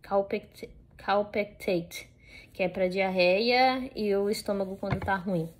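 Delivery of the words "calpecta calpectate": "Calpectate" drawn out slowly, after a false start; careful pronunciation. Real, not acted. Dog owner repeating the medicine's name for viewers, careful slow pronunciation of "Calpectate". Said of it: "eu acho que se fala assim".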